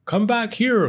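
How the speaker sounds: calling out. Acted. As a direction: call him back. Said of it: "called Sam".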